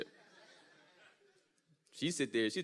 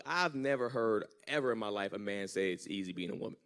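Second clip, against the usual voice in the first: ordinary talk, nothing marked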